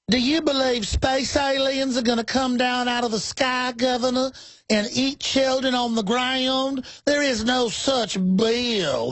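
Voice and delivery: high, slow Southern rooster drawl; Foghorn Leghorn impression. Host mocking a congressman as a cartoon Southern rooster. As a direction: ham up a blustery cartoon Southern senator. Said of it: "Foghorn Leghorn".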